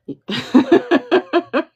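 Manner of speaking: high, loud delighted laugh; burst of laughter. Real, not acted. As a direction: laugh out in disbelief. Laughing at the cards.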